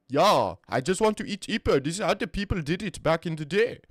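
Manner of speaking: lively put-on South African accent. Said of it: "Okay, it's not bad".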